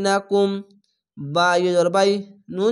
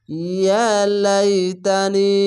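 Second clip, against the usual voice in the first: very slow chanted recitation, long held vowels; Quran recitation; much slower than usual. Shown as a demonstration. Teacher reciting a verse for students, slow and chanted. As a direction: stretch yeah out in a chant. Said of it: "এক আলিফ টেনে পড়বেন".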